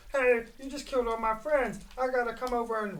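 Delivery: high, far put-on voice for the target; voicing the enemy. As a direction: give the enemy a silly squeaky voice. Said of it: "This guy is like".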